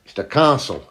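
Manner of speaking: "castle" for council, with a long AH in place of OW; old-timer's "castle" with an open AH; great-granddad's pronunciation. Retold or imitated. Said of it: "That's how he would say it".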